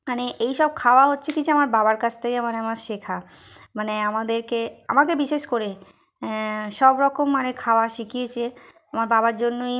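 talking while chewing; speaking between bites of food; mouth full. Real, not acted. Seen 0: Bites repeatedly; food at lips, cheeks full while speaking.

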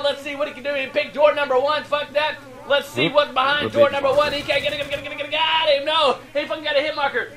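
excited play-by-play. Real, not acted. Commentator following a player up the hill, excited play-by-play. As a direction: keep the pitch up as the action unfolds. Live call of a tense play.

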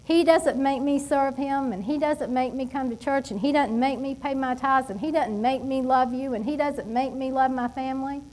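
ordinary delivery

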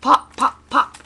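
sharp, high, loud bursts; storybook sound effect; popping balloons. A narrator's reading. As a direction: punch out each pop separately.

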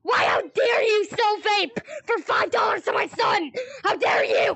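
hammed-up tearing into someone; outraged parent. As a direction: wail it in acted outrage. Furious parent confronts vape seller.

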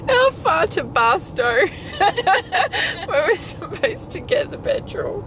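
slow, words squeezed out through laughter; laughing question. Real, not acted. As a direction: ask while still laughing.

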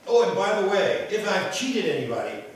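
high, loud eager add-on; eager Zacchaeus. Acted. Preacher voicing Zacchaeus's afterthought, high and eager. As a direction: toss it in with zeal.

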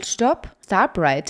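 ordinary talk

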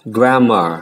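grammar said slowly, GRA-mmar with open a; slow teacher model of grammar; English word grammar. Shown as a demonstration. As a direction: model grammar clearly for learners. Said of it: "Đó là grammar".

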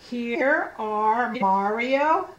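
slow, word-by-word group reading; slow read-along. Shown as a demonstration. Teacher asking children to read with her, slow read-along. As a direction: stretch each word so children can join in.

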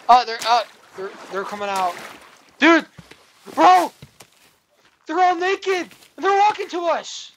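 high, loud startled shouts; freaking out at the naked mobs; shocked yelling. Real, not acted. Naked mobs walking toward them.

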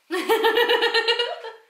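high burst of laughter. Real, not acted. Laughs at child on call.

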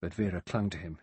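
ordinary delivery, nothing marked

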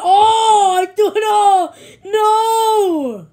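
high, loud anguished cry; dismayed shout. Real, not acted. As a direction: cry out as the lead slips away. Losing the race lead.

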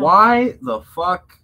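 drawn-out incredulous yell; exasperated outburst. Real, not acted. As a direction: burst out in disbelief.

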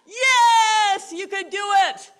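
loud, high yelled yes; crowd roar. Retold or imitated. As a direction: roar back. Crowd answers.

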